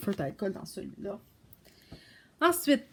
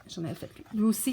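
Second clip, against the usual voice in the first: ordinary delivery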